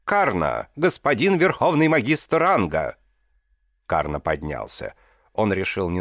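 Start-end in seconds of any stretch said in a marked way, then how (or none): 0.0-3.0 loud, high herald's proclamation; royal herald. A narrator's reading. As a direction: call it out across the arena. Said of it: "глашатай, наконец, объявил".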